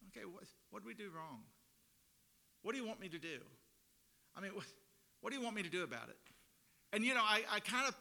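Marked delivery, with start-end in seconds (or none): 0.1-6.2 put-on bored, dismissive teen sigh of a question; eye-rolling sarcasm. The people roll their eyes at God, sulky and dismissive. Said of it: "rolling their eyes going".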